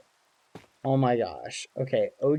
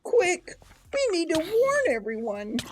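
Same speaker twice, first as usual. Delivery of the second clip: much higher, stuttering cartoon fright; high squeaky panic with stutter; melodramatic panic. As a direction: squeal it like a doomed little bird. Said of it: "a bit of a melodramatic rooster".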